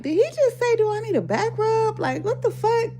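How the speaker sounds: high, disbelieving repeat; can't believe he asked; incredulous. Retold or imitated. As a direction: pitch way up in shock. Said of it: "I'm like".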